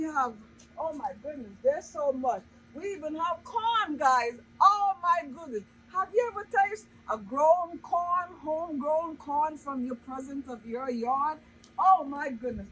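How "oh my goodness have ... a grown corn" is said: ordinary talk, nothing marked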